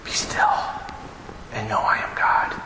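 hushed whisper; a soft whispered reminder to the congregation. Shown as a demonstration. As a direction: breathe it out in a whisper. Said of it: "breathing exercise then whisper".

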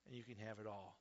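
a quiet, coaxing Satan; hushed tempter. Retold or imitated. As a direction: murmur the temptation low. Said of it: "Satan says".

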